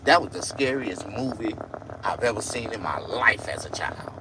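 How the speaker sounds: normal talking voice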